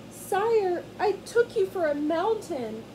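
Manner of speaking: high, timid squeak of a frightened mouse; squeaky scared mouse. Acted. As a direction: squeak it out, barely brave enough. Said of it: "barely enough courage to squeak".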